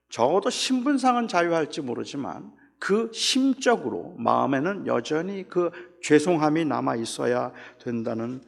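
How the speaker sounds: ordinary talk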